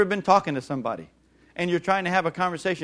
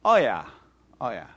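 dismissive oh yeah; 6 semitones lower than usual. Retold or imitated. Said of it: "they'll go like this".

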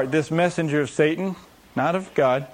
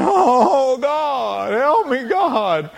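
high, loud, hammed-up begging; mock pleading prayer; 10 semitones higher, 6 dB louder, much slower than usual. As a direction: wail to God in exaggerated desperation. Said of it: "'Cause all of you have pleaded".

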